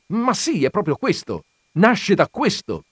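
a touch of heated irritation; with anger. A narrator's reading. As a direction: snap it back at her. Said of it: "rispose lui con rabbia".

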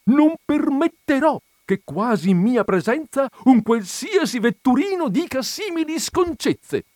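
high, indignant scolding; a lady drawing herself up, high and offended; indignant outrage. Acted. The colonel's wife scolding the landlord, high and indignant.

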